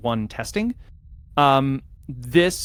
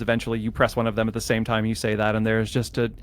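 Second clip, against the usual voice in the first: ordinary talk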